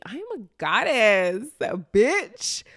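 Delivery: high, loud, drawn-out sassy boast; exuberant affirmation. Shown as a demonstration. Speaker hyping themself with affirmations, exuberant and sassy. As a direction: proclaim it big, stretching the key word. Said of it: "saying my affirmations".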